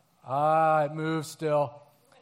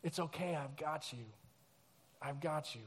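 much softer, lower comforting voice; soothing hush; 6 semitones lower, 13 dB quieter than usual. Retold or imitated. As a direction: hold him close and calm him. Soothing crying son.

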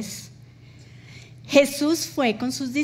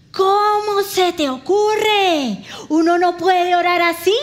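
higher, louder, slow reproach; scolding husband; 5 semitones higher, 5 dB louder than usual. Retold or imitated. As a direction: act the shocked husband scolding her.

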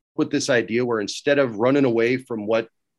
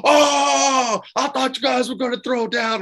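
much higher teasing exclamation; mocking young wrestlers; 12 semitones higher than usual. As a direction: tease like eager young guys. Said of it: "And they're all like".

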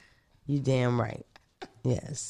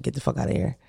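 muttered dismissal; 5 semitones lower, 6 dB quieter, faster than usual. Retold or imitated.

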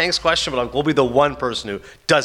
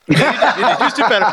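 giggling agreement, caught up in the joke; laughing; 8 semitones higher than usual. Real, not acted. Host laughing along at the director's brag, giggling.

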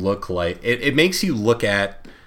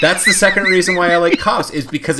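loud, laughing and higher; 10 semitones higher, 7 dB louder than usual. Real, not acted. Explaining why he loves Cops, laughing loudly. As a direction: talk through a real laugh. Laughing after his own joke.

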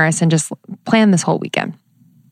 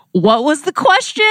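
confused guest; 8 semitones higher than usual. Retold or imitated. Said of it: "the guest who's just like".

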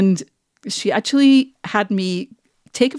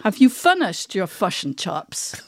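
slow New Zealand question; New Zealand accent; slower than usual. Shown as a demonstration. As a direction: ask slowly with exaggerated New Zealand vowels. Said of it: "they just have the weirdest vowels".

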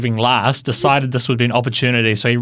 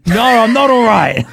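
exasperated retort; 9 semitones higher, 5 dB louder than usual. Retold or imitated. Answering driver while chasing horse.

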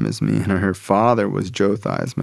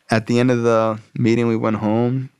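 usual speaking voice

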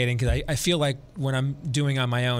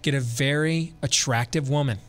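usual speaking voice

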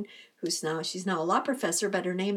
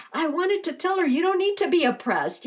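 imitating indignant friend; 7 semitones higher, 5 dB louder, faster than usual. Cheryl quoted, indignant.

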